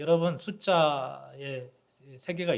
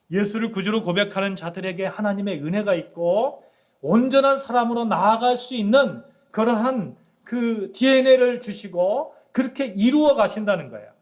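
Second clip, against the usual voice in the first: louder, higher preaching build; full-force preaching; 5 semitones higher, 6 dB louder than usual. Acted. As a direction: proclaim it to the congregation with fire. Preaching climax. (설교 절정에서 회중에게 힘차게 외치듯)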